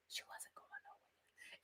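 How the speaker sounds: slow, barely audible whisper; dramatic whisper. Pretend. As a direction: whisper it slowly for dramatic effect. Repeats the line as a whisper.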